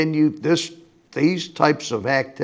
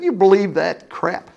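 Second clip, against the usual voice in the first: scoffing; 6 semitones higher than usual. Retold or imitated. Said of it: "they actually scoffed at me and said".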